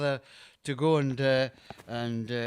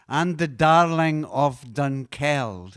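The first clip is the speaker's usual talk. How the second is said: very slow, louder Scots line; slow Scots chorus line; 6 dB louder, much slower than usual. Shown as a demonstration. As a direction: spell it out slowly in Scots. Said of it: "go through the chorus".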